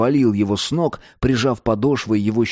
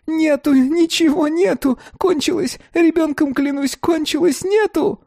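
shrieky, very high frantic denial; squealing. A narrator's reading. Beaten dealer swears on his child, squealing. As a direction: squeal it higher as the panic mounts. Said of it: "визжал громче и громче".

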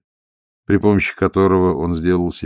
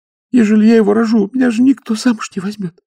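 put-on much higher girlish voice, begging through tears; girl begging the stoker through tears; tearful pleading; 7 dB louder than usual. A narrator's reading. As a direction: plead like a desperate young girl. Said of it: "Девушка перестала рыдать".

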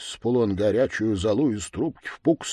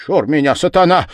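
higher, louder warning; clerk warding off Satan; 6 semitones higher, 8 dB louder than usual. Acted. Clerk at the keyhole to Satan, raised commanding voice. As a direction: call it out sharply to ward off evil. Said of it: "продолжал писарь, приложась губами к скважине".

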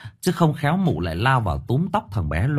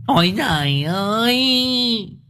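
very high, drawn-out slow wail; mother wailing to the heavens; wailing cry; 12 semitones higher, much slower than usual. A narrator's reading. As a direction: let the cry stretch out like a lament. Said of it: "Liên Ngọng nó lại gào lên".

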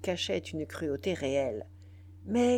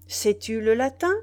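loud, pressing question; brisk; 3 semitones higher, 8 dB louder than usual. A narrator's reading. General pressing the brigand about Latin, briskly. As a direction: repeat the question louder, rising at the end. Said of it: "reprit vivement Biassou".

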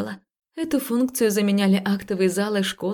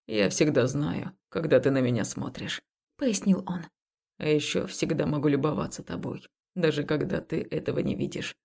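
tender low voice; 5 semitones lower, 4 dB quieter than usual. Acted. Man speaks tenderly in the dark.

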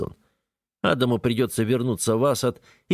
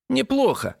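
mocking copy of his tone, much higher; parodying his intonation. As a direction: echo his word back, teasing. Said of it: "пародируя мои интонации".

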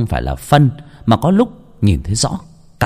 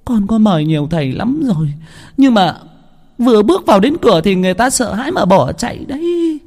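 higher, tearful pleading; choking sobs; 10 semitones higher than usual. A narrator's reading. Wife tells the healer through choking sobs. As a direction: sob out the story. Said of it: "Bà Lý nghẹn ngào thút thít".

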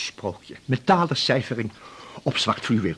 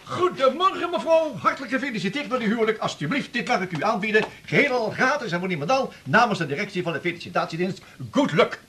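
high, eager sales patter; pushy salesman; 9 semitones higher than usual. Acted. Salesman gushing at a newlywed on her doorstep. Overeager door-to-door salesman greeting.

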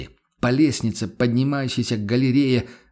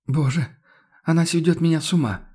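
mild moan; 6 semitones higher than usual. A narrator's reading. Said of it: "простонала Мария Дишер".

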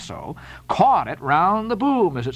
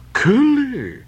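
higher, louder scornful cry in broken English; disdainful cry; 9 semitones higher, 4 dB louder than usual. A narrator's reading. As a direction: spit the word out in disdain. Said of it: "cried Queequeg".

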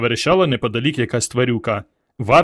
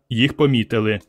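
ordinary delivery

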